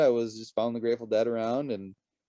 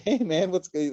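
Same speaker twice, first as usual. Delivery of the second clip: bright, eager hey man, much higher; excited greeting; 10 semitones higher, 7 dB louder than usual. Retold or imitated. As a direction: greet a new friend with a lift. Retold greeting to stranger.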